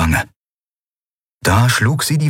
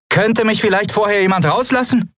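urging. A narrator's reading. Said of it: "drängte Lien".

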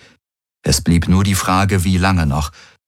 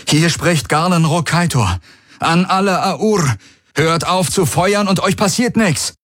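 high, projected call to surrender; loudspeaker announcement; 10 semitones higher than usual. A narrator's reading. Garland orders enemy troops to cease fire, calling over his helmet loudspeaker. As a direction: address a whole battlefield in a raised, high voice. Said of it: "Er aktivierte seinen Helmlautsprecher".

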